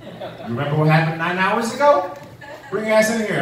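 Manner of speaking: dad ready to punish, dead serious; stern dad. Retold or imitated. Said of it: "and I hear".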